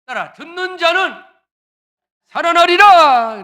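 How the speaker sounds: loud, slow shouted proclamation; full-force preaching. Acted. Pastor shouting to the congregation, full-force preaching.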